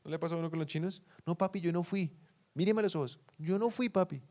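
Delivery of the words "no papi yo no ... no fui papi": imitando una súplica de inocencia, más aguda; negación fingida. Retold or imitated. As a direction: finge defenderte con voz aguda. Imita a alguien negando su culpa. (inocencia fingida en tono agudo)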